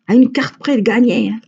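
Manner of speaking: playful amazed retelling; amazed exclaim. Retold or imitated. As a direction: marvel at how close she came. Retelling the near win.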